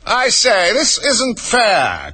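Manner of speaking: high, drawn-out indignant cry; high-pitched unicorn complaint. A narrator's reading. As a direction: protest loftily, stretching the last words. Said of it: "cried the unicorn".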